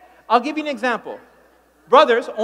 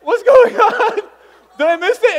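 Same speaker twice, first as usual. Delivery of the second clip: confused guy; 9 semitones higher, 8 dB louder than usual. Retold or imitated. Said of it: "He was like".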